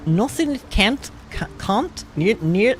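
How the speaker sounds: attempted Scottish accent. Shown as a demonstration. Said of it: "I can't do it".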